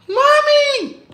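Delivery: put-on very high child's yell for mommy; scared child cry. Kids at the party calling their mother, high scared yell. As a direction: shriek like a frightened kid. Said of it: "Them kids were like this".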